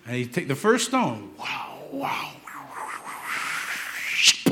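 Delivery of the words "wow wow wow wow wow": whispered whooshing of a swung sling; whirling sling noise. Acted. Preacher mimics David swinging the first stone, whispered whooshes. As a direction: whoosh round and round, building to the release.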